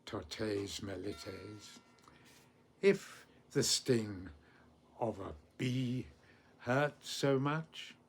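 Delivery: slow recital broken by long pauses; very slowly. Shown as a demonstration. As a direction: give the verse piece by piece. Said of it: "I'll say it very slowly".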